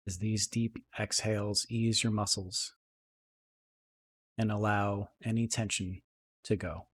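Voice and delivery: soft, unhurried meditation voice with long pauses; guided meditation. Acted. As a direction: settle into a quiet, even, calming guide's voice.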